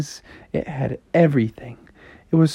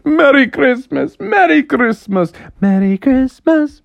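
high, loud festive calls, easing off; family cheering; 14 semitones higher, 5 dB louder than usual. Acted. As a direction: several happy cartoon voices in turn. Family all cheer together.

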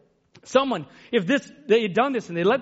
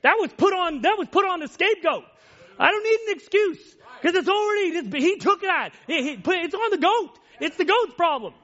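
raised, pleading no-excuse voice; a believer's answer; 7 semitones higher than usual. Retold or imitated. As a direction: answer the accuser, a bit higher. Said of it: "that person can say".

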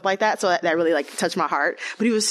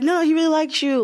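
high and louder, eager friend voice; pushy excited friend; 12 semitones higher, 4 dB louder than usual. Retold or imitated. Friends urging her to date the boy, excited high voice. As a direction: push her like an insistent friend.

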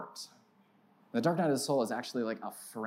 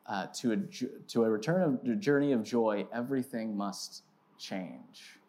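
read definition; 3 semitones lower, 3 dB quieter than usual. Real, not acted. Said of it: "This is my definition".

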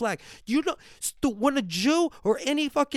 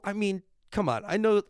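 ordinary talk, nothing marked

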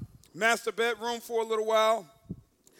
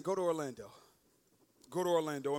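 low, soft, calm instruction; still small voice; 8 semitones lower, 6 dB quieter, faster than usual. Retold or imitated. Inner voice to man in condo, low and soft. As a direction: murmur it low and gently. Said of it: "I hear a still small voice".

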